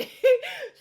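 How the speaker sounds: loud laugh bursting out high; quick loud high laugh. Real, not acted. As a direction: crack up at it. Laughs at Nicole walking out.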